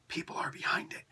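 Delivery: a quick unvoiced whisper of the correction. Shown as a demonstration. Vlogger whispering a correction to viewers about the comic. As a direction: slip in the fix under your breath.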